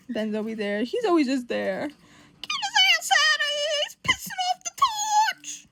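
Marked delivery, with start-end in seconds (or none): 1.9-5.7 squealed outrage. Acted. Defending the torch from an intruder, squealed outrage. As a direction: yell it in hammed-up indignation. Acted order to remove someone.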